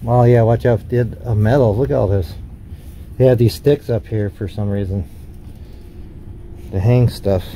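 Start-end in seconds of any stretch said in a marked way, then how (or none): none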